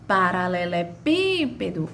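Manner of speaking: Paralelepípedo with a long, drawn-out PÍ; stress on PÍ. Shown as a demonstration. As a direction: linger on the "pí" in Paralelepípedo. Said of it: "foi a que eu demorei mais pronunciando". (sílaba PÍ bem arrastada em Paralelepípedo)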